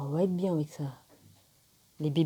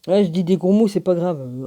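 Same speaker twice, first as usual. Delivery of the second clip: loud defiant; 3 semitones higher, 9 dB louder than usual. Real, not acted. Defiant outburst about swearing.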